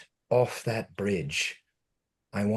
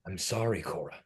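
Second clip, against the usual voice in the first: soft, fading apology; quiet apology; 6 semitones lower, 11 dB quieter than usual. Acted. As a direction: let it trail off softly.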